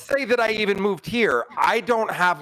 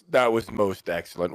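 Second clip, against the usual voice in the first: deep, satisfied in-character reply; hammed-up deep contentment; low character voice; 9 semitones lower than usual. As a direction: drop low and relish it. In-character reply after being healed.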